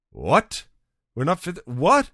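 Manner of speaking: rising, disbelieving questions; incredulous what. Retold or imitated. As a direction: react as if you can't believe your ears. Reacting to pilot's alarming news.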